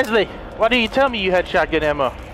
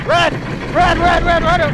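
high, urgent shouts to run; panicked shout; 8 semitones higher than usual. Real, not acted. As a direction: yell it over and over in panic. Fleeing boss in the game.

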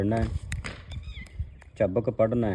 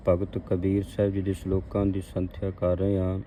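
ordinary talk, nothing marked

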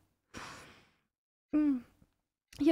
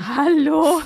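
loud startled Hallo; loud shout; 11 dB louder than usual. Real, not acted. Startled greeting. (lauter erschrockener Ruf)

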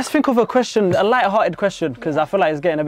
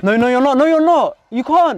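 louder, higher, firm insistence; insistent; 5 semitones higher, 7 dB louder than usual. Real, not acted. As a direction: refuse it loudly. Refusing a friend tapping out.

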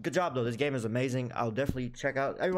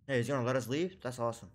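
ordinary talk

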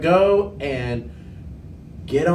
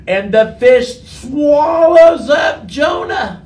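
high, loud, drawn-out storytelling climax; dramatic exclamation; 8 semitones higher, 7 dB louder, slower than usual. A narrator's reading. Storyteller reaching the moment the fish gets Jonah, big dramatic exclamation. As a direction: call it out big and slow for the kids.

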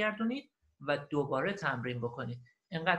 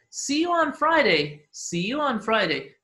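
slow, raised, louder teaching delivery; slow model phrase; 6 semitones higher, 7 dB louder, much slower than usual. Shown as a demonstration. Teacher models a goodbye for learners, slow and clear.

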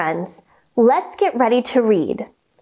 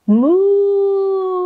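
cow moo; 6 semitones higher than usual. Shown as a demonstration. Yoga teacher leading kids in cow pose, playful moo. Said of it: "let me hear your best cow sound".

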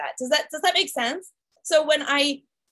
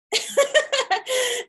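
high laugh; 12 semitones higher than usual. Real, not acted. After telling the webinar how she got hooked, high laugh. Laughing at her own story.

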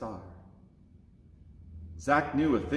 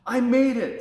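triumphant; 9 semitones higher, 7 dB louder than usual. Acted. Zach reaches Bethlehem.

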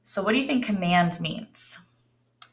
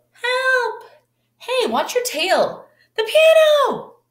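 much higher, shouting alarm; panicked yelling; 5 dB louder, slower than usual. Acted. Dancers shout as the dinosaur wrecks the studio, high and panicked. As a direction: yell for help as chaos hits.

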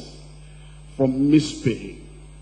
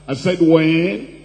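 louder, slow, drawn-out demand for an answer; insistent repeat; 3 semitones higher, 7 dB louder than usual. Real, not acted. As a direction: press the congregation loudly, stretching the question. Pressing congregation for an answer.

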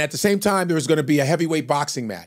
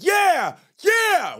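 crowd yell. Retold or imitated. Fans going crazy ringside, yelling. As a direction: yell it like a crazed fan.

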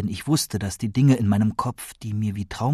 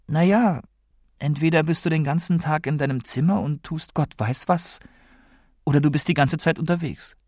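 lightly voiced grandmother, higher-pitched; concerned grandmother; 6 semitones higher than usual. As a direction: list worries as a gently probing grandmother.

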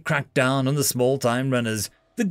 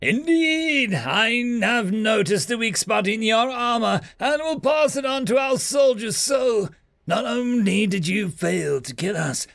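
shrill-pitched alien victor shouting; gloating alien; 8 semitones higher than usual. Acted. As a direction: crow over the pinned human.

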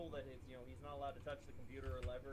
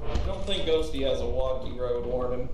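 shouting; 3 semitones lower, 16 dB louder than usual. Real, not acted. Yelling over chat.

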